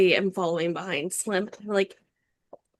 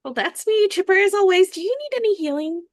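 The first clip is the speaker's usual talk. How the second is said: high, bright and chipper voice; chipper gnome; 11 semitones higher, 3 dB louder than usual. Acted. Gnome offering the orc healing, high and chipper. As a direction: play the eager little healer, much higher.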